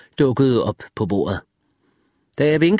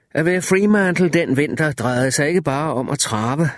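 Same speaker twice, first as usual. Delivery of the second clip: plain reading voice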